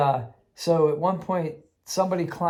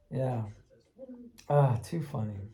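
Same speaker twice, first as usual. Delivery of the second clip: ordinary delivery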